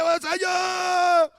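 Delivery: high, loud shout, the cry stretched out; mock howling. Preacher mimicking people who think yelling nears God, mock howling. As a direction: howl it out like an over-zealous worshipper. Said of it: "ils commencent à hurler".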